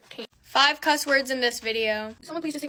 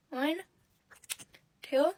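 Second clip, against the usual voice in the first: normal talking voice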